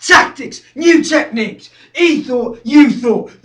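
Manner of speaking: loud, punched phrases; aggressive rant. Acted. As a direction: fire off each point hard. Fight tactics, heated.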